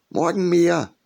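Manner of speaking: put-on high, loud demanding young phoenix. A narrator's reading. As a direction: demand it like an eager young bird. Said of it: "forderte der Phönix".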